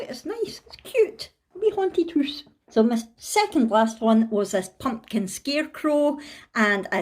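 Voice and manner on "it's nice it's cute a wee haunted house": cutesy coo. Real, not acted. Cooing over her own drawing.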